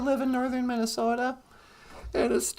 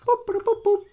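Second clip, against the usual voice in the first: computer boops; 7 semitones higher than usual. Pretend. Imitating computer beeps.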